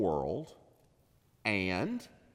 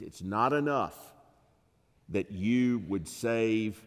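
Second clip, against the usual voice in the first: ordinary talk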